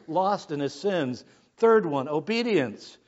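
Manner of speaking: usual speaking voice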